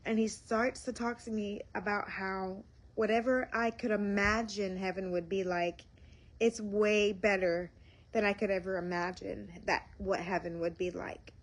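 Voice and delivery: normal talking voice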